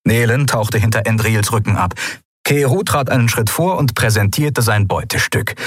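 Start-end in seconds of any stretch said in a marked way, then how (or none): none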